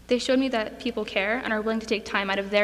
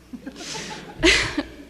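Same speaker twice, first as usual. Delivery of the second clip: light laugh; 7 semitones higher than usual. Real, not acted. Laughing while describing Mister Ham.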